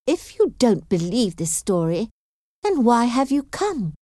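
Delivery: challenging question with a touch of anger; mildly angry. A narrator's reading. Elizabeth challenging her visitor's motive, mildly angry. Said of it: "Elizabeth asked in an angry voice".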